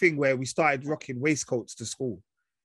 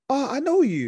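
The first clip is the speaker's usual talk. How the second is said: excited fan; 11 semitones higher, 5 dB louder than usual. Retold or imitated. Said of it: "People going".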